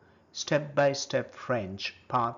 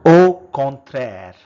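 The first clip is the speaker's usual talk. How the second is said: first take, projected clearly for the class; loud model word; 15 dB louder than usual. Shown as a demonstration. Teacher modelling a French word for learners, loud and clear. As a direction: call out the French word loudly.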